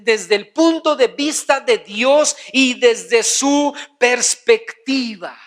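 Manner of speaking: high, emphatic pulpit delivery; forceful preaching. Real, not acted. Preacher explaining godly discernment to the congregation, forceful preaching. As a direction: preach it hard, stretching key words slowly.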